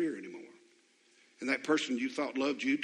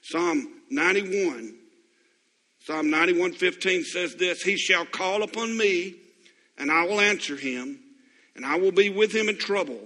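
loud, higher scripture proclamation; booming scripture read; preached scripture; 5 semitones higher, 8 dB louder than usual. Acted. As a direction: proclaim the verse from the pulpit.

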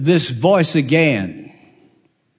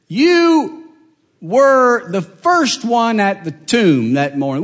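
prosecutor's voice; 7 semitones higher, 3 dB louder, slower than usual. Acted. Prosecutor voiced, accusing Mary.

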